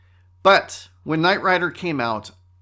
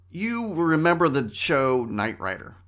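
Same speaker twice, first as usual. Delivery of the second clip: ordinary talk, nothing marked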